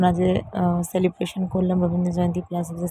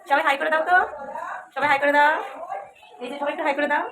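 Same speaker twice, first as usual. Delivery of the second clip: loud, high call to the class; 8 semitones higher, 4 dB louder than usual. Real, not acted. Asks class kids to say hi.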